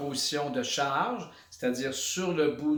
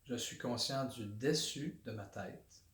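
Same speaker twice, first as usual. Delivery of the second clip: guided body scan; 3 semitones lower, 6 dB quieter than usual. Shown as a demonstration. Instructor leading listeners through a body awareness exercise, calm meditation voice. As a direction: guide them inward quietly, one body part at a time.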